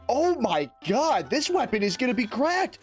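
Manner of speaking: ordinary delivery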